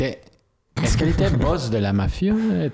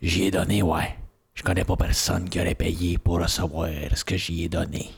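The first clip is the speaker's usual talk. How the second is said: voix de Jerry; 5 semitones lower than usual. Acted. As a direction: drop low and play the biker. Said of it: "belle voix de Jerry". (voix grave et sourde de dur à cuire)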